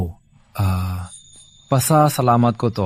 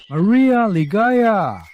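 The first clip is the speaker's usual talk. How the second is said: high, long-drawn shout of a name; name stretched out in a high call; 11 semitones higher, much slower than usual. Acted. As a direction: holler it slowly into the distance. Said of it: "Ba't ka sumisigaw?".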